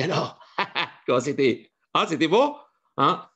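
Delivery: lightly voiced eager moocher; nosy freeloader. Freeloaders quizzing the guest about the meal, eager. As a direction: ask eagerly, sniffing for a meal. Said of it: "piques-assiettes qui dit".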